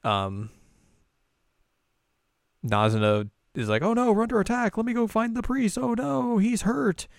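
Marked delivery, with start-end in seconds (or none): 3.8-7.1 high, mock-panicked little girl. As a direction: squeak out the alarm. Voicing panicked girl Nazuna.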